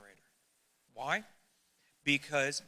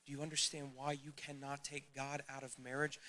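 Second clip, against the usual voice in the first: ordinary talk, nothing marked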